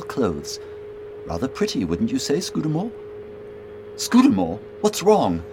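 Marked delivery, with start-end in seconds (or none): 4.0-5.4 higher, louder worried query; startled and worried; alarmed concern. Acted. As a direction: call out in sudden concern. Companion suddenly turns pale.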